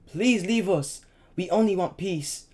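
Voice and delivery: high, loud terrified plea; father screaming at the soldiers; screaming in fear. A narrator's reading. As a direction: cry out for mercy. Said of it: "screamed in fear".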